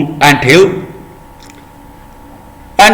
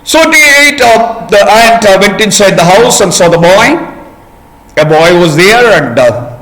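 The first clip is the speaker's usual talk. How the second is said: ordinary delivery, nothing marked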